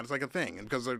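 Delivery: usual speaking voice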